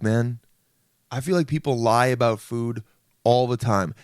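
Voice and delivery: ordinary delivery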